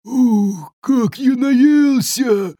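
high-pitched, drawn-out troll contentment; stuffed troll boasting. A narrator's reading. Sated troll rejoices on the glade, groaning contentedly. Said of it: "забасил тролль, громко икая".

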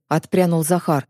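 ordinary delivery

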